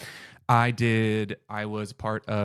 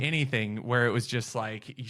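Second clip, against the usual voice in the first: normal talking voice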